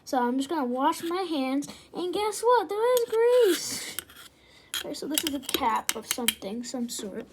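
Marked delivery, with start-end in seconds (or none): 1.9-4.0 mock surprise. Real, not acted. Sarcastic reveal of grease.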